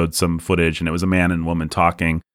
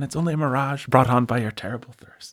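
bad Irish accent; 8 semitones higher than usual. Podcaster answering Wayne in a mock Irish accent. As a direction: play a scoffing Irishman, hammed up. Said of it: "do a bad Irish accent".

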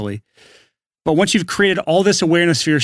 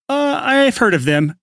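unsure; 10 semitones higher, slower than usual. Retold or imitated. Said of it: "to take someone from".

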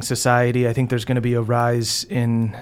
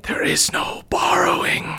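eerie voice in the head; 6 semitones higher than usual. Acted. Dioana rebuking Moonshine for borrowing, eerie whisper. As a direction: intone it as an otherworldly stage whisper.

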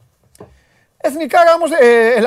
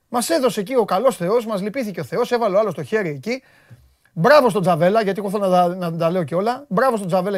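ordinary talk

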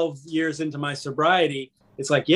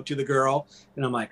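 ordinary talk

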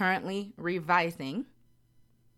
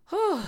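heavy whew sigh; 5 dB louder than usual. Real, not acted. Sigh after recalling rejections.

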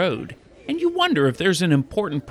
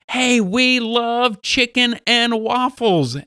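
higher, slowed, drawn-out fan greeting; enthusiastic young fans; 6 semitones higher, slower than usual. Retold or imitated. Two listeners recognizing the podcast host, imitated enthusiasm. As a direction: imitate fans in a raised, stretched-out voice.

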